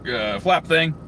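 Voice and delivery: ordinary talk, nothing marked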